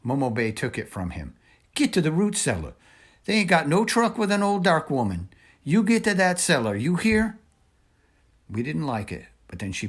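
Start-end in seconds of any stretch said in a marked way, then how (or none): 1.8-7.4 put-on higher, louder voice of an old woman giving orders; an old woman pushing the children to safety. A narrator's reading. Voices Momo Bay urging the children.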